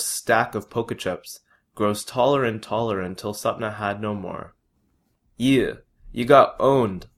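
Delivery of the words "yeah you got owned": slower, louder, gleeful taunt; gleeful gloat. A narrator's reading. Gaurav gloating at Sapna after the card game, gleeful. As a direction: savour the win over her. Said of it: "Gaurav gleefully tells Sapna".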